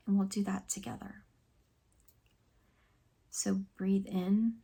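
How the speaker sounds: soft, calm meditation guide with long pauses; hushed, soothing relaxation voice; guided meditation. Acted. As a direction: gently lead them through breathing and reflection, leaving space.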